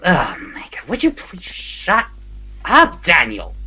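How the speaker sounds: exasperated plea, breaking from hushed to high. Acted. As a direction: tell off a bickering friend, dragging out the words. Acted squabble between two cellmates.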